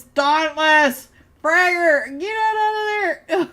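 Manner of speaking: high, loud panicked yell; yelling warning. Real, not acted. Caster yelling at player Fragger to flee, high loud yell. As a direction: shout at him to run.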